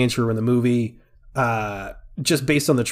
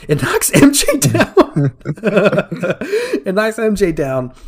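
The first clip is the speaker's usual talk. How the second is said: higher, louder laughing fix of the name; laughing correction; 8 semitones higher, 6 dB louder than usual. Shown as a demonstration. Host fixing his slip of the name, laughing. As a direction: correct yourself through a laugh. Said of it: "I keep saying Gwen".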